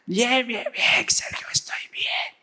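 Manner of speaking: imitating someone who lost his voice; strained whisper, playing sick on the phone; voiceless pastor. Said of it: "Me quedé sin voz". (imitando una voz afónica)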